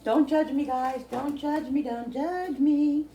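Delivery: high and playful, fingers in mouth; licking fingers. Real, not acted. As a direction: say it while licking her fingers. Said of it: "Yes, I'm licking my fingers".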